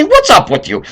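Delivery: high, pointed demand; confronting challenge. Retold or imitated. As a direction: call him out to his face. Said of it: "I'd like to say".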